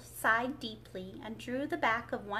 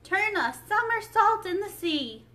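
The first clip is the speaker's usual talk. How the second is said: high, loud excited call; crying out; 9 semitones higher, 8 dB louder than usual. A narrator's reading. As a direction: cry out the wild instruction. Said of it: "cried the Mock Turtle".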